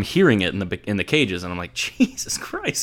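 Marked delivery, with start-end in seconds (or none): 1.7-2.8 slow, breathy whispered awe; amazed whisper. Retold or imitated. Patient hearing a pro hit in the cage, slow whispered awe.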